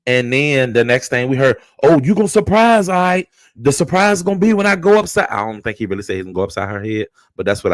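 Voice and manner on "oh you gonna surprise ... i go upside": mock threat. Husband threatening Skillet.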